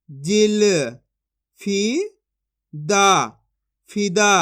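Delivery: each syllable said apart, then the whole word firmly; syllable drill. Shown as a demonstration. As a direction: chant it out like a reading drill for kids. (अक्षर-अक्षर जोड़कर पढ़ाने वाला अभ्यास)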